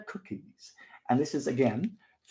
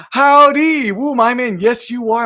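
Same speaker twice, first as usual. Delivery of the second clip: loud, high excited greeting; 7 semitones higher, 11 dB louder, slower than usual. Real, not acted.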